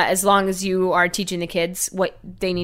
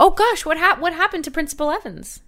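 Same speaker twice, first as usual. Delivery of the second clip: mom voice; 10 semitones higher than usual. Acted. Parent voice.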